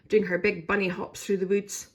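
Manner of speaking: ordinary delivery, nothing marked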